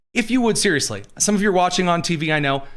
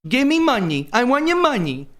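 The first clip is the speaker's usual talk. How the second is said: whiny victim voice; 8 semitones higher than usual. Acted. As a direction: whine for money like a spoiled brat. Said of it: "I did the voice for the Discord crew".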